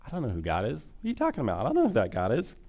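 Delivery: incredulous brush-off in a put-on voice; dismissive Pharaoh. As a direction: play a scornful ruler with sliding, questioning pitch. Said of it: "what does Pharaoh say".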